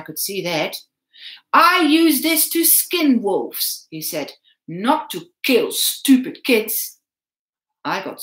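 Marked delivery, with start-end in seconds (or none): 1.5-7.0 slow, louder, contemptuous; slow menace. A narrator's reading. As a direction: hold up the knife and sneer.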